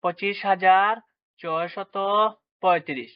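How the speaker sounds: pauses after হাজার and ছয়শত, হাজার lifted; number read-out demo. Shown as a demonstration. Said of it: "এটির উচ্চারণ হবে".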